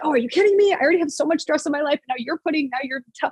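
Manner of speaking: high, exasperated complaining; mock-whine like a fed-up client; retold high-pitched gripe about the extra drill; annoyed client. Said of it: "my clients would get annoyed".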